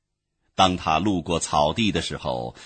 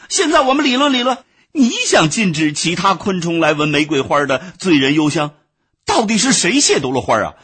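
much higher, louder, rushed outburst; furious shout; 9 semitones higher, 7 dB louder than usual. A narrator's reading. Exasperated ant loudly argues back at the bee. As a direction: lose his temper and shout her down. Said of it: "气急败坏， 大声地说".

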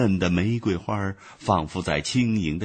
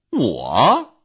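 louder indignant "我"; indignant bee; 3 semitones higher, 6 dB louder than usual. A narrator's reading. Bee indignantly echoes the ant's question. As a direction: bristle at the question. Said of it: "蜜蜂气愤地说".

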